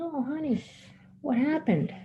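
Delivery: put-on high-pitched sympathy for a hurt child; soothing concern. Teller to the bandaged boy, retold soothing concern. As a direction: coo with concern, voice lifted high.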